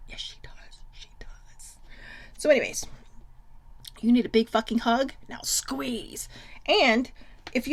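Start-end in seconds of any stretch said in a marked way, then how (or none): 0.1-1.8 very soft unvoiced whisper; whispered insist. Real, not acted. Teasing her sister to viewers, whispered. 5.3-6.3 soft unvoiced whisper; whispered squeeze. Real, not acted. Telling viewers to squeeze, whispered.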